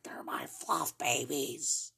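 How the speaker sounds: slow, hushed whisper. Retold or imitated. Telling her about the marshmallows in a slow whisper. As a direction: breathe it out slowly like a secret.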